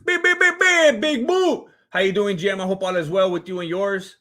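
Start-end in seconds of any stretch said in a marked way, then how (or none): 0.0-1.7 high, loud stuttered horn-like greeting; hyped shout-out. Real, not acted. Streamer greeting a viewer in chat, hyped shout-out. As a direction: repeat the first word, then stretch the name out high.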